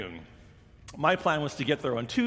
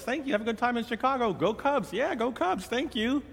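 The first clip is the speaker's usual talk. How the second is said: much higher, quick cheerful exchange; cheery call-out; 9 semitones higher than usual. Retold or imitated. Passengers swapping good wishes between gates, high cheery voice. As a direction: toss friendly lines across the gate. Passengers calling out while passing.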